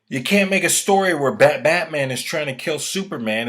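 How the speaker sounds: nearly shouting in frustration; loud exasperated rant; heated rant. Real, not acted. Ranting, raised voice at Batman plot.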